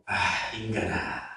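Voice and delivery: very soft, low sighed address; deep sigh. Acted. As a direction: sigh the words out. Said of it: "한숨을 푹 쉬면서".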